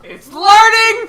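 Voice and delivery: excited shout. Real, not acted. Player delighted the creature is learning, loud slow excited shout. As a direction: call it out in delight.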